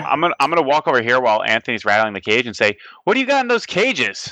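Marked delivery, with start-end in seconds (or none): 3.0-4.3 raised character voice. Acted. Player voices character question.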